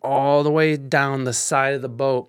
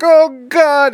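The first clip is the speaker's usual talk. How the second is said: high, louder horrified oh God; horrified cry; 10 semitones higher, 7 dB louder than usual. Retold or imitated. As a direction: recoil from the rising filth. Backing away from shit water.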